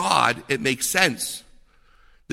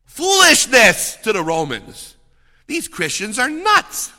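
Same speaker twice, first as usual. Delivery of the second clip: higher, louder scoffing voice of a Roman crowd; hammed-up Roman scorn, higher and louder; mocking Romans; 7 semitones higher, 7 dB louder, slower than usual. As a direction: scoff at the Christians as lunatics.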